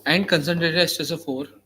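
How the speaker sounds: slow, measured dictation; dictating. Shown as a demonstration. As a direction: read it out for students to copy. Dictating notes to students.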